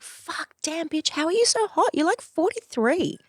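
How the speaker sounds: delighted disbelief; shrieking self-admiration. Pretend. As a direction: squeal at your own reflection. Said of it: "I'm always like".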